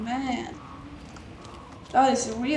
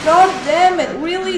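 loud frustrated yell; angry outburst; 6 semitones higher, 10 dB louder than usual. Real, not acted. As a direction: shout at the locked door in anger. Locked out, monster near.

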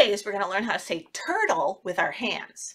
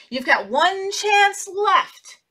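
stern fairy; 8 semitones higher, much slower than usual. Acted. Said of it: "and she said".

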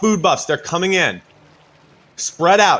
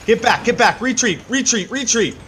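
frantic retreat order; 6 semitones higher than usual. Real, not acted. Raid leader calling retreat.

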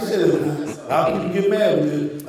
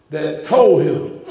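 ordinary delivery

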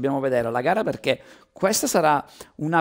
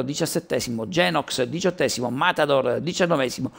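fast race commentary, rising with the action; live play-by-play. Real, not acted. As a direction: call the qualifying lap live. Reading out results.

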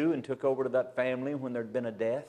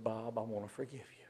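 gentle whispered; 2 semitones lower, 11 dB quieter, faster than usual. Retold or imitated.

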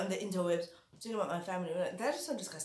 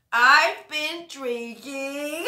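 much louder and slow, stretching every word; big drawn-out confession; 5 semitones higher, 10 dB louder, much slower than usual. Acted. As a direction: announce it like a grand reveal. First take, drawn out and loud.

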